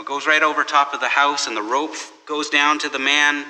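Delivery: usual speaking voice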